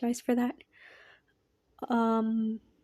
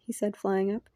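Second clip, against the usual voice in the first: ordinary delivery, nothing marked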